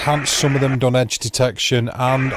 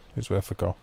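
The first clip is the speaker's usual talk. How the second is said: much softer and lower, as if to himself; quiet echo; 5 semitones lower, 10 dB quieter, faster than usual. Real, not acted. Repeating his line to Steve, quietly to himself. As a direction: let the repeat drop away under your breath. Echoing own line under breath.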